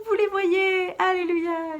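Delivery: high, drawn-out celebratory cry; joyful high-pitched reveal; mock-triumphant hallelujah. Real, not acted. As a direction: proclaim it like a small miracle. Triumphant reveal of both yarns together.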